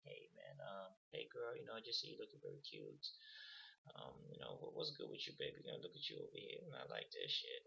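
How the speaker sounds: soft unbothered charm, half whispered in places; laid-back chill. Retold or imitated. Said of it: "you could just be in a laid-back, chill mood".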